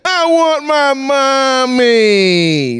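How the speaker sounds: high, long drawn-out bawl; mock child wailing. Shushed baby brother calling for mother, exaggerated wailing. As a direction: wail like a little kid, stretching every word. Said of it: "They cry even louder".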